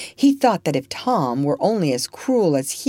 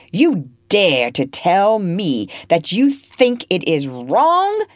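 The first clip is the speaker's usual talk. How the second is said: roaring with rage; 3 semitones higher, 5 dB louder, slower than usual. Acted. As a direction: bellow it out at the slave, crimson with passion. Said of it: "he roared at last, crimson with passion".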